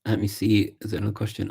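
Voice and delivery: ordinary talk, nothing marked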